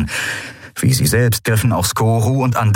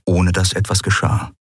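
ordinary delivery

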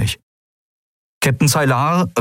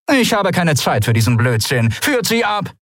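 higher, curt captain's order; dismissive command; 10 semitones higher than usual. Acted. Captain ordering her crew to take them away, curtly. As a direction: snap the order and turn away.

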